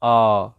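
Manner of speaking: آ held as an open "aah"; long AH sound. Shown as a demonstration. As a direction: sound out آ alone, drawn out. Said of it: "الصوت بتاع ال آ".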